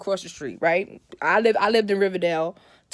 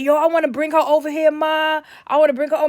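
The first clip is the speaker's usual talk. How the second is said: uncle's plea; 6 semitones higher, 5 dB louder than usual. Retold or imitated. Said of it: "he was like".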